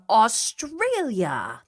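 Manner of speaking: AUSTRALIA stretched out with a high swoop, then falling; showy, drawn-out "Australia", not quite an accent; stank on it. Real, not acted. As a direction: put some exaggerated stank on "Australia". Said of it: "put some stank on it".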